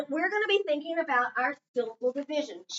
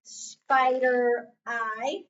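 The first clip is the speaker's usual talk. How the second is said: slow reveal; 4 dB louder, much slower than usual. Shown as a demonstration. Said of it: "And I have spider I".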